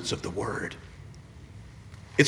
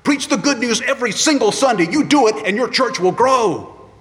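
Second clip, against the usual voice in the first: high, loud urgent plea; wild-eyed insistent mentor; urgent charge; 10 semitones higher, 7 dB louder than usual. Retold or imitated. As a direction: grab him and drive it home. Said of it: "He said, shook me".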